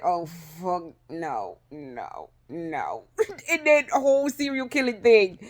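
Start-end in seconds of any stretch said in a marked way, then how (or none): 0.0-3.0 low, soft horrified no no no; hushed dread. Retold or imitated. Her brain recoiling from sadness, murmuring a horrified no. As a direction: shrink back, murmuring a frightened no. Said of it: "It was just like".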